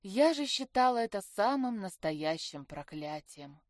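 plain reading voice

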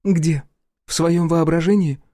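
mock his excuse; biting sarcasm. A narrator's reading. Ex-wife scoffs at his googling, sarcastic.

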